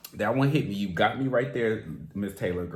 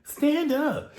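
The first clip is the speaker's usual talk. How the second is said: urging cheer; much slower than usual. Real, not acted. Fan urging the heartbroken singer to rise, high rallying cry. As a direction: lift her up with it.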